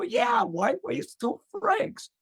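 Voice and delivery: high, slow, drawn-out agreement; agreeing eagerly. Retold or imitated. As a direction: echo her words, won over. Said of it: "So I go".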